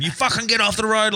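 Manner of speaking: high-pitched imitated road rage; mock angry yell. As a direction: pitch it up like a cursing driver. Acting out a driver yelling.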